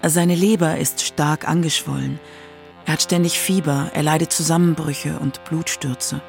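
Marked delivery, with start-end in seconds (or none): none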